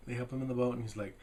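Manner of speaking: usual speaking voice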